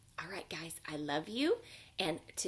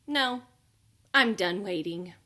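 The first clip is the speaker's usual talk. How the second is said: louder, fed-up little bunny; impatient bunny; 7 dB louder than usual. Acted. Bunny refuses to keep waiting, loud and fed up. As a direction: done with waiting, say it with a huff.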